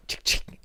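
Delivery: clicking noise whispered through the teeth; mock gun click. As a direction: breathe out a gun-cocking click. Mimicking a gun being loaded.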